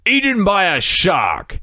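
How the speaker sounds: high, overacted British storyteller; bad British actor. Podcaster retells islander's shark story, hammed-up British actor voice. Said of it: "he's the worst actor I've seen".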